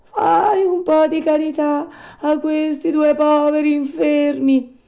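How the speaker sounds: high, loud beggar's wail; whining. A narrator's reading. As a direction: plead for alms in a drawn-out whine. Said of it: "con voce di piagnisteo".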